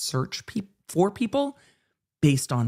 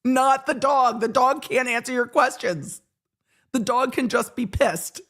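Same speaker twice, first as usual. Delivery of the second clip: lecturing retort; 6 semitones higher than usual. Retold or imitated. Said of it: "I'm like".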